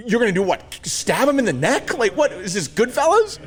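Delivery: high-pitched comic disbelief; incredulous. Retold or imitated. Answering brother about the broken bottle, incredulous. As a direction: pitch way up, appalled and amused.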